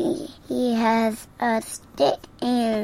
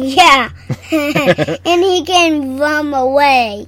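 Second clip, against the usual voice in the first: laughing; 3 semitones higher, 10 dB louder, slower than usual. Real, not acted. Kid laughing while talking.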